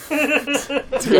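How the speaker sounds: laughing, pitched high. Real, not acted. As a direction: laugh through it. Laughing repeat.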